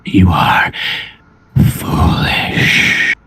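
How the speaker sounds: low, rasping dragonborn menace; deep gravelly voice. Acted. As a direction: growl it slowly from deep in the throat. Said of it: "A deep, gravelly voice".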